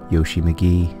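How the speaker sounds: normal talking voice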